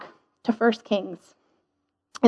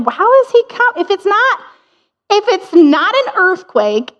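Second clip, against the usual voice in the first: high puzzled Elijah; 8 semitones higher than usual. Retold or imitated.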